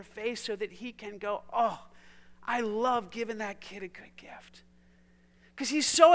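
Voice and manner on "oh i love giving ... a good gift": szept pełen zachwytu; whispered delight. Pretend. God delighting in his child.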